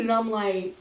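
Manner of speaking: ordinary talk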